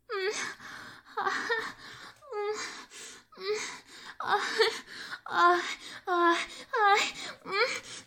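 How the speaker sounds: escalating moaning; rising moans. Acted. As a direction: moan your way up toward climax, pitch rising. Acted climax build, rising moans.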